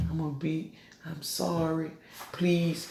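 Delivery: a hint of a shrinking apology, almost under the breath; mock meek pleading. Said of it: "No, no, man. The Lord talk with authority".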